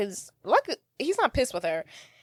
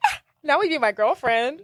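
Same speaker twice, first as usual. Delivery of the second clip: mock gasp; 5 semitones higher than usual. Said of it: "I think I actually gasped".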